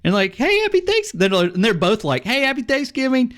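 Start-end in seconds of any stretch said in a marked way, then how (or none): 0.4-1.1 very high, squeaky retold hello, cut off; cheery greeting. Player greeting the second fighter, very high cheery hello. 2.2-3.3 high, sing-out mock holiday wish; cheery greeting. Both fighters greeting back, high cheery voice. Both players answer together.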